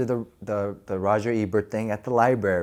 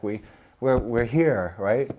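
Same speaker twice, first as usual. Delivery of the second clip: usual speaking voice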